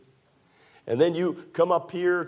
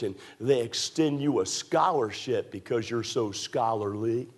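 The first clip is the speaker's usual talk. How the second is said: ordinary talk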